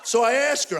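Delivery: normal talking voice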